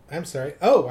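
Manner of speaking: ordinary talk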